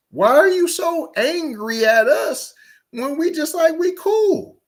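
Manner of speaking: high-pitched, incredulous questioning; incredulous challenge. Real, not acted. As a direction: pitch it way up in disbelief. Challenging the clip speaker's anger.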